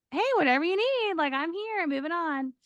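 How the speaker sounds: high, breezy shrug of a grief counselor. Retold or imitated. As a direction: let the threat slide right off you.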